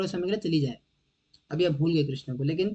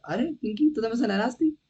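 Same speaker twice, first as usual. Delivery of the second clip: higher-pitched, lightly put-on coaxing; acted boyfriend on phone; 5 semitones higher than usual. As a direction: play the boyfriend talking to his girlfriend. Acts boyfriend on phone with girlfriend.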